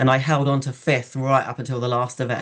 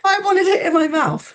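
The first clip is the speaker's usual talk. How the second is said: very high, loud mock-crying protest; comic wail; 6 dB louder than usual. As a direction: wail it like a hammed-up tantrum. Retold wail after second drenching.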